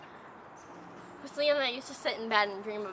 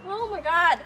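high, louder tearful laughing; louder, higher teary astonishment; excited laughing tears; 6 semitones higher, 5 dB louder than usual. Real, not acted. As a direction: marvel at a twin through tears. Meeting look-alike sister.

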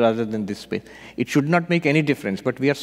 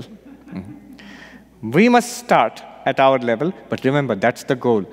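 ordinary talk

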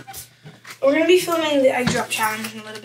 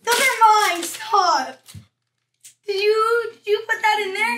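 higher, louder, indignant questioning; upset and loud; 7 semitones higher, 5 dB louder than usual. Real, not acted. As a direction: demand an answer, upset. Victim discovers his ruined lunch.

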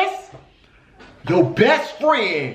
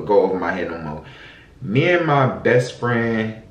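ordinary talk

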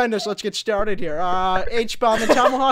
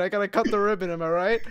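normal talking voice

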